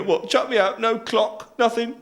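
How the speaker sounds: high-pitched wounded disbelief; acted aggrieved disbelief; incredulous protest. Sacked bloke protests.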